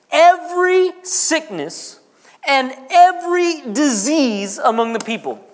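every sickness, every stretched and emphasized; slow preacher's emphasis on "every"; stress on every. Shown as a demonstration. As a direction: lean hard on "every" each time. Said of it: "Somebody say every".

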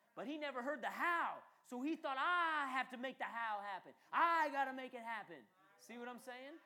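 high, loud preacher's voice; impassioned sermon delivery; full-force preaching. Acted. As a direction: preach it out to the congregation. Extended preaching with raised voice.

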